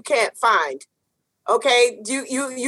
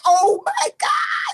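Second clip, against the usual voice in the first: high, slow, overjoyed cry; excited squeal; 7 semitones higher, slower than usual. Retold or imitated. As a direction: gush like a thrilled new hire. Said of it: "You were all excited".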